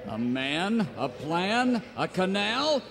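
ordinary talk

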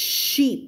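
"sheep" with a long, airy sh; airy SH sound. Shown as a demonstration. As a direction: let lots of air through the "sh" of "sheep". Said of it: "เสียงมันจะลมเยอะๆ". (ออกเสียง sh ลมเยอะๆ ในคำว่า sheep)

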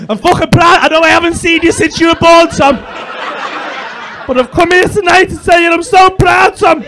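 loud, high, hammed-up proud father; proud dad. Comic as absent father addressing soldier son, hammed-up proud shouting. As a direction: shout it like an overwhelmed long-lost dad, mock-emotional. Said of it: "Is that your dad, is it, Tom?".

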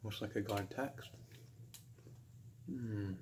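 eating between words; talking while chewing. Real, not acted. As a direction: mouth partly full of cake. Seen 0: Bit cake at -3; holds it, jaw moving while speaking.

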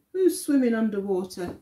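fast, brisk repeat; quick repeat. Acted. Teacher repeating the line to children, quick. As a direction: rattle it off again.

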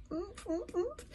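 high, soft mm-ing through food; muffled grunts. A narrator's reading. Dragon warning friends with mouth stuffed, muffled grunts. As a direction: grunt urgently, lips closed. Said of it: "mouthful of fortune cookies, unable to speak".

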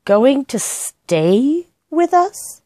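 very slow, high disbelieving repeat; incredulous echo. A narrator's reading. Housekeeper repeating the news, incredulous. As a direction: stretch it out in disbelief. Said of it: "echoes Judy's announcement".

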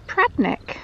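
Pradnick in two syllables, middle one dropped; Pradnick, run together as locals say it; local pronunciation. Shown as a demonstration. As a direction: say Pradnick the lazy Cornish way. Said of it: "Locals pronounce the word as".

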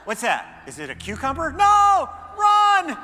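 loud, high panicked cat voice. Acted. Preacher voicing a terrified cat in a video. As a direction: shriek like a startled cat.

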